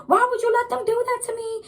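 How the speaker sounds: high, acted-out indignant outburst; going off. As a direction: wail the question high at the cop. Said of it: "Just going off on them".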